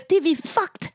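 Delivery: put-on high-pitched child with a Greek accent; little Greek accent. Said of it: "in his little Greek accent".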